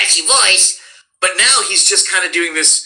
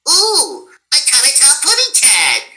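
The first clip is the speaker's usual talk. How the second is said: put-on much higher baby-talk bird voice; Tweety; 11 semitones higher than usual. Voice actor turning Bugs into Tweety, much higher baby talk. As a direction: coo it like a tiny cartoon canary. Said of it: "it becomes Tweety".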